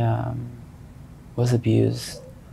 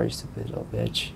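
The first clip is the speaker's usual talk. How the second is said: a much lower, softer menacing dad voice; dad's threat; 9 dB quieter than usual. Retold or imitated. Son imitating his father threatening the sister, low and menacing. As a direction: growl it low like the abusive father. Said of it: "And my dad was like".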